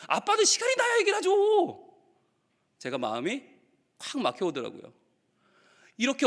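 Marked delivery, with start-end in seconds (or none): 0.1-1.8 very high-pitched mock whine; complaining child. Child asked about confiding in dad, complaining. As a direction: imitate an exasperated kid's protest. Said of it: "아빠한테 얘기해봤어?".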